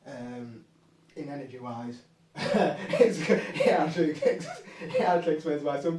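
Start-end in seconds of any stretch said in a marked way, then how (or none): 2.4-6.0 high, loud, breaking up with laughter; laughing while talking. Real, not acted. Speaker cracks up explaining the energy to listeners, laughing. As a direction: try to explain through a fit of laughter.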